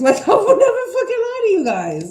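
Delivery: high, loud, laughing through it. Real, not acted. Reader repeating her promise to viewers, laughing. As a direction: crack up as you repeat the promise.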